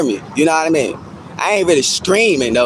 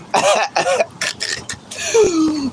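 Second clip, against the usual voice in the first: long breathy-tailed laugh; laughter; 8 semitones higher, 4 dB quieter than usual. Real, not acted. Streamer laughing at own comeback to chat, amused laugh. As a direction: laugh it out after the jab.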